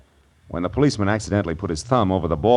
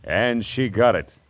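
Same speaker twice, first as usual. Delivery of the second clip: higher, punchy detective voice-over; dramatic teaser; 6 semitones higher, 5 dB louder than usual. Acted. Marlowe teasing next week's murder story, dramatic announcing. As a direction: sell next week's mystery, pausing between clauses.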